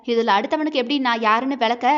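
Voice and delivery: plain reading voice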